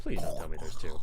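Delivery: loud, rasping whispered creature voice; unvoiced monster impression, pushed hard; Frienderman. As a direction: croak it out forcefully without any voice. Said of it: "That's a pretty good impression".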